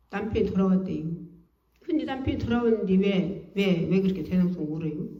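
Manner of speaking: ordinary delivery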